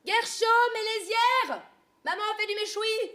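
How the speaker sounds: high, loud call across the house. Acted. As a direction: call the kids in for dinner. Mother calling kids to eat.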